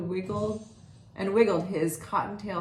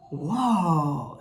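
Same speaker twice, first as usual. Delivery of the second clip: awed whoa; 5 dB louder than usual. Acted. Porcupine amazed at the library.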